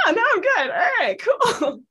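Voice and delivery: laughing mock breeziness. Shopper leaving cry chamber, laughing mock casual. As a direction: brush it off cheerfully through laughter.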